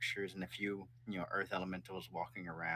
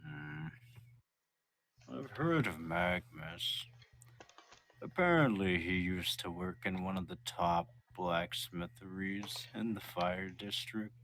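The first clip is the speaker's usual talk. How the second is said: thoughtful Lutu; slower than usual. Acted. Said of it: "Lutu goes".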